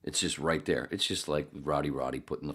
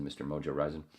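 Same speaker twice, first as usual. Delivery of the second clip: normal talking voice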